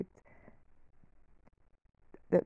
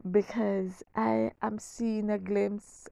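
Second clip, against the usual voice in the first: usual speaking voice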